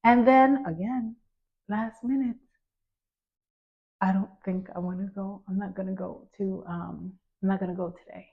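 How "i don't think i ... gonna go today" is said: reluctant mumble. Retold or imitated. Son backing out again.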